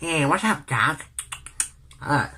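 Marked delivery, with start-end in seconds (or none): 0.0-1.1 lightly imitated cartoon rabbit; a hint of cartoon rabbit; Bugs Bunny. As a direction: toss off a cartoon-rabbit catchphrase. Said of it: "or my Bugs Bunny was probably good".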